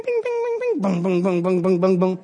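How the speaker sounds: pings and booms. Retold or imitated. Pastor sounding out the prison break from the jailer's side, pings then booms. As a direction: sound out the chains, then the quake. Imitating chains and the quake.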